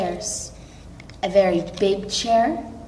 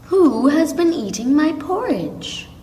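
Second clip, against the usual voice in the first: Mother Bear, a bit higher; medium-sized voice; 6 semitones higher than usual. A narrator's reading. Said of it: "in a medium-sized voice".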